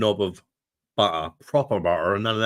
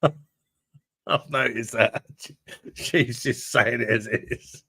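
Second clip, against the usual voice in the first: laughing while talking; 4 semitones higher, much slower than usual. Real, not acted. Laughing at Jennifer's blunt chat comments.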